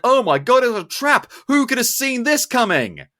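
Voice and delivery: high, loud fake astonishment; mock surprise. Film fan mocking characters walking into a trap, hammed-up surprise. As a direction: exclaim it with hammed-up shock. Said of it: "they act all really surprised".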